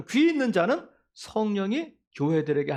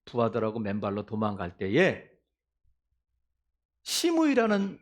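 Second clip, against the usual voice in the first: ordinary delivery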